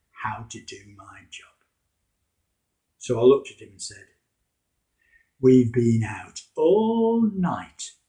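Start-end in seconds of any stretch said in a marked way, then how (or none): 5.4-8.0 louder, very slow and dripping with sarcasm; sarcastic. Acted. Simon answering Jesus's fishing advice, sarcastic and drawn out. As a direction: drag it out to mock the advice. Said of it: "In my best sarcastic voice".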